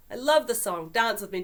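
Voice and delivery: loud, high and eager, calling him up; bursting with excitement to dance; an eager friend pulls him onto the dance floor, loudly thrilled; excited invitation. Acted. Kate leaps up to dance.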